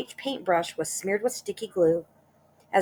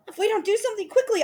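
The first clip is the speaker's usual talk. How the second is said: high, fast panicked gabble; panicky; 4 dB louder, faster than usual. Acted. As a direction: gabble in terror. Mrs Twit panics upside down.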